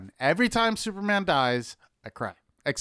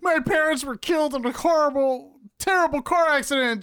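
much higher hammed-up sob story; mock tragic backstory; 10 semitones higher, 3 dB louder than usual. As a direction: overdramatic tale of woe. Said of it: "George is that guy that's just like".

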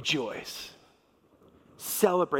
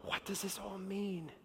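almost under the breath, much softer; a bewildered sinner asking half to himself; puzzled murmur; 15 dB quieter than usual. Retold or imitated. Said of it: "the tax collectors and the sinners just going".

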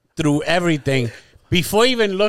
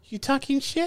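higher, quieter put-on voice, teasing; mock tough-guy challenge; 9 semitones higher, 6 dB quieter than usual. Friend imitating angry Danny confronting Alyssa, mock tough guy. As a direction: squint and ask if they're talking trash. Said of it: "He goes".

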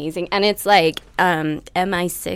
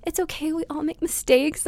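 put-on light British accent, higher; 8 semitones higher than usual. Imitating Mary Berry comforting a baker, light British accent. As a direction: gently reassure a baker in British English. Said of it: "give us your British accent one more time".

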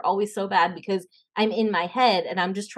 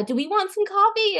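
mock flubbed line; 12 semitones higher than usual. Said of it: "you say".

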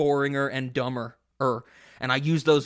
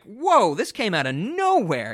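high, drawn-out mock whoa; astonished reaction; 6 semitones higher, 4 dB louder than usual. Viewer reacting to the sudden gore, astonished. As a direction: act out being blindsided, pitch leaping up.

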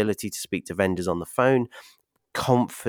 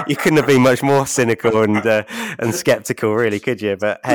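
teasing laugh; 2 semitones higher, 7 dB louder than usual. Real, not acted. Teasing guest while laughing.

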